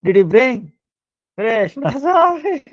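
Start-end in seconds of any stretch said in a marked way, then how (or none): 1.4-2.6 high, giggling "sorry"; laughing apology. Real, not acted. Preacher laughing while correcting his own slip, giggling.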